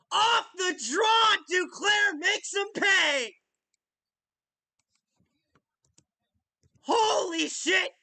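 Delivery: loud, high, slowed shouts of joy; excited yelling. Real, not acted. Fan reacting to the goal, shouting. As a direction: keep screaming in disbelief.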